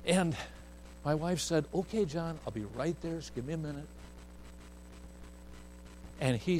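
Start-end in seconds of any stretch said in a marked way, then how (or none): 1.7-3.9 soothing mom. Retold or imitated. Wife soothing the child.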